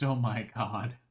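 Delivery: ordinary delivery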